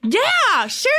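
high, drawn-out bratty retort. Acted. Podcaster told they're being a brat, high bratty reply. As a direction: throw it back like a brat. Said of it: "you'd be good at being a brat".

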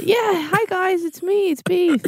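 squeaky little pig voice; chirpy high pig greeting; high piggy voice. Acted.

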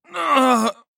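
high, loud cry of pain. A narrator's reading. Balmer cut off mid-boast by sudden pain. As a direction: yelp as the pain hits. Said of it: "Balmer suddenly groaned".

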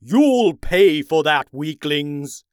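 slow, loud threatening shout; angry shout. A narrator's reading. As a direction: shout the threat like a furious villain. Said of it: "the Ice King shouted".

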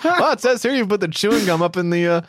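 reading through laughter; laughing while reading. Retold or imitated. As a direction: voice lifted and giggly. Acting out friend reading instructions.

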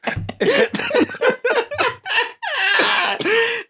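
high shrieking laugh; howling laugh. Real, not acted. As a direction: laugh till you cry. Helpless laughter.

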